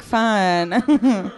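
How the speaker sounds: usual speaking voice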